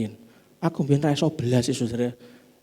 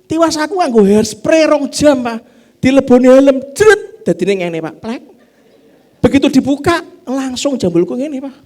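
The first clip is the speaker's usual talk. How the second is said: higher and louder mock complaint; complaining wife; 11 semitones higher, 9 dB louder than usual. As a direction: ham up the wife fussing over her hair. Wife complaining about helmet hair.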